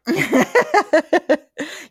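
loud, high burst of laughter. Real, not acted. Host laughing at the self-deprecation joke, loud high laugh.

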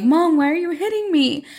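high, whiny mock-sob plea; high pleading mock-cry. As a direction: imitate a daughter crying to her mom. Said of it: "And Monse's crying and is like".

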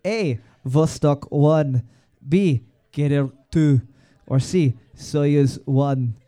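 put-on slow Russian accent on the options; drawn-out Russian accent reading. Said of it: "That's my Russian accent".

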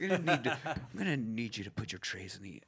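low, soft, dragged-out cabin request; Lumberg impression. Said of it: "do Lumberg while you do that".

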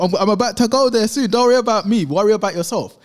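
loud, high re-enacted comeback; raw retort. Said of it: "just raw".

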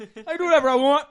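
fast, high, loud protest; defiant yelling. Retold or imitated. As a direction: blurt it out loudly, acting the defiant man. Retold man defies the cop.

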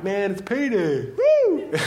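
high, gleeful whoop on woo; excited beggar. Pretend. Blind beggar eyeing a crowd of givers, gleeful whoop. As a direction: cheer at the crowd like a payday.

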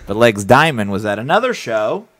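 ordinary delivery

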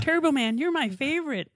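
put-on high, excited little kid voice; imitating a kid. Kid cheering along with the TV, high and excited. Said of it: "fighting along with the T V and going".

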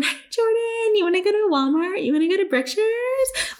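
high-pitched mock wheedling; sing-song pleading. Asking best friend for a ride, mock sing-song pleading. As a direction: imitate sweetly pestering a friend in a high voice.

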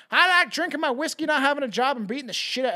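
put-on failed Irish accent, high and loud; a botched Irish lilt. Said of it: "that's not an Irish accent".

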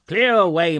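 loud, high, hearty command; booming boss rallying his lads; jovial shouted order. A narrator's reading. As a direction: shout it merrily across the room.

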